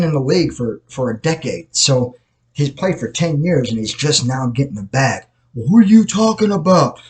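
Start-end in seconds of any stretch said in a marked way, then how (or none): 5.5-7.0 imagined viewer. Pretend. Voicing an imagined viewer's question.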